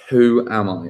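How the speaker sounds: ordinary delivery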